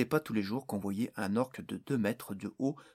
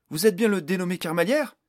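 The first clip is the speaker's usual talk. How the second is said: loud, higher reporter question; eager journalist; 8 semitones higher, 10 dB louder than usual. A narrator's reading. Journalist questioning the magician, eager loud voice. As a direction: push the question like a keen reporter. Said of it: "lança un journaliste".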